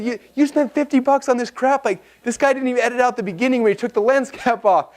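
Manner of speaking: high-pitched, incredulous teasing; mock your buddy for his dumb purchase; mocking friends. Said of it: "B J and Garrett start laughing at me".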